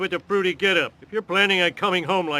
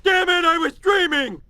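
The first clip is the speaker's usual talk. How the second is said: frustrated shout; 12 semitones higher than usual. Acted. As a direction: yell it out in frustration. Wakes from dream, frustrated.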